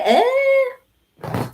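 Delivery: mock high questioning eh. Real, not acted. Teasing viewers about her parents.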